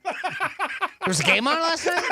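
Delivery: mock surprise. Joking about missing the game.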